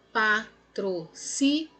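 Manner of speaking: "Patrocínio" spelled out slowly, syllable by syllable. Shown as a demonstration. Teacher slowly splitting "Patrocínio" into syllables for the class. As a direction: break "Patrocínio" into slow, separate syllables. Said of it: "Pá, pausadamente".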